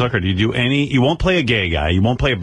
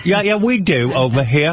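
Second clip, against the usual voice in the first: higher, playful British voice; British accent; 5 semitones higher than usual. Acted. Host answers the doubters in character, British accent. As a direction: argue back as a put-on Brit. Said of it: "British say mate".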